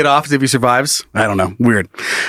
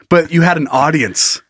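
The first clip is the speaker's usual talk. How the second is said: ordinary delivery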